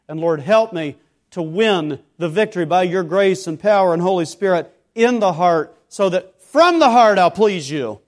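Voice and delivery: a preacher's sudden earnest prayer; fervent prayer. Acted. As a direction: pray it out with mounting fervour.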